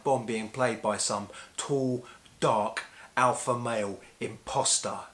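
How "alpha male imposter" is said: normal talking voice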